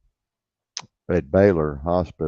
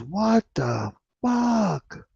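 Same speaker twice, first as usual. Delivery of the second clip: drawn-out, much higher disbelieving what the fuck, said slowly; shocked outburst; much slower than usual. Retold or imitated. Cop friend hearing the record, slow shocked disbelief. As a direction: stretch it out in pure disbelief.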